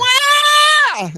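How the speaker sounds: very high, loud engine wail; imitated engine scream. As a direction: screech like a plane at full power. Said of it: "sorry for your ears".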